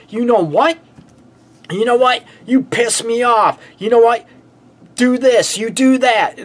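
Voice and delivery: high, mock-angry accusations; attacking a partner. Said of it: "you start attacking your partner".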